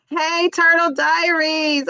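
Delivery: high, slow sing-song call; drawn-out playful greeting; lightly voiced kids' show hello; Turtle Diaries voice. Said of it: "I gotta channel me some Turtle Diaries".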